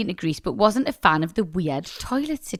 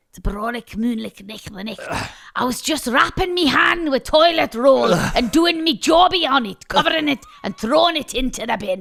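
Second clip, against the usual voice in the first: put-on Scottish accent. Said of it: "it says cue [name] Scottish accent".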